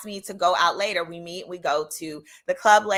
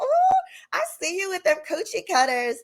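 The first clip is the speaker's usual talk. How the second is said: flirty tease; 10 semitones higher than usual. Retold or imitated. Flirty teasing about his shorts.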